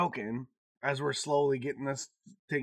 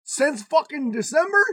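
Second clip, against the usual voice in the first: louder, higher, exasperated rant; loud rain rant; 10 semitones higher, 8 dB louder than usual. Real, not acted. Venting to a friend about the constant rain, loud and exasperated. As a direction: complain about the weather at full volume. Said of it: "ranting about the rain".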